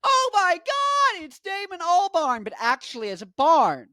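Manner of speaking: gasping high overexcited squeal, easing down; gasp, then stretch it out high in mock amazement; Connor. Vlogger showing how Connor would do it, overexcited impression. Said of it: "how would Connor do it".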